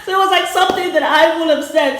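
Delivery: fast, high words carried on real laughter; laughing. Real, not acted. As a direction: burst out laughing, then talk through it. Amused at sharing the thought.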